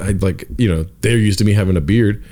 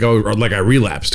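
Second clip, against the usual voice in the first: ordinary delivery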